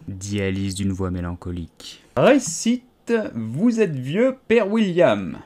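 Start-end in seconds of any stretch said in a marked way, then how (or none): 2.2-5.4 high, loud commanding creature voice; ordering caterpillar. A narrator's reading. Caterpillar commands Alice to recite the poem. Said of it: "ordonna la chenille".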